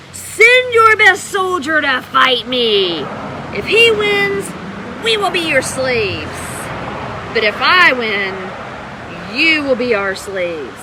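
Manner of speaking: slow, loud giant's challenge; Goliath shouting. Acted. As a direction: bellow it across the valley like a bully giant. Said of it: "Goliath came out and shouted".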